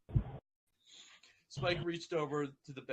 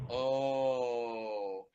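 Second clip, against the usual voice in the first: moan; 3 dB louder than usual. Acted. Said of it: "he moaned".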